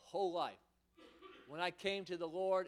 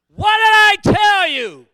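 slow, high, full-force shout; booming rebuke; 10 semitones higher, 26 dB louder, slower than usual. Retold or imitated. God thundering at the discouraged preacher, slow high shout. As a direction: bellow it like an angry father. God's rebuke recalled in mind.

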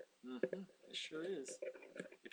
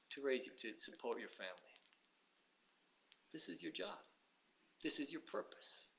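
quiet firm reply; 8 dB quieter than usual. Acted.

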